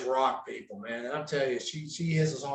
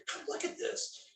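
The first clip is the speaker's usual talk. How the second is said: urgent whisper to come over; whisper, calling a friend to the window; hushed urgent invitation; 15 dB quieter than usual. Retold or imitated. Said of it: "She said".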